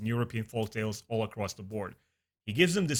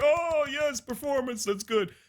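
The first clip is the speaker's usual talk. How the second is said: high, hammed-up leering voice, much higher; mock-delighted gawking brute, pitched way up; leering villains; 12 semitones higher, slower than usual. Duloc males ogling the dancers, hammed-up high leer.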